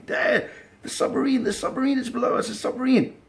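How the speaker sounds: put-on high-pitched frantic yelling of a kid; excited scream. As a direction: scream it out like you just saw a monster shark. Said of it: "I started screaming".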